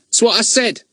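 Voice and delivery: high, teasing mock of Ern; mimicking Ern. Fatty teases Ern by echoing him, high and mocking. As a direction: parrot him back cheekily.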